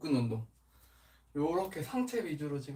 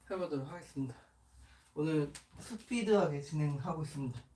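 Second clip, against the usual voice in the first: usual speaking voice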